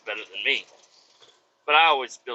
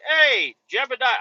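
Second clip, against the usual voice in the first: calling out; 11 semitones higher, 5 dB louder than usual. Retold or imitated. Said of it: "I'm like".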